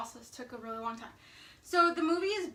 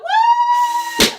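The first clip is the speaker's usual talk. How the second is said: very high loud whoop; excited whoop; 16 dB louder than usual. Real, not acted. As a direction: scream the woo. Balloon pop cheer.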